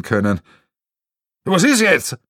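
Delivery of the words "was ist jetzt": high, loud bark. Acted. Gruff man barks at the intruders, loud and high. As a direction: bark the question at them. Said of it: "bellt der Polterer".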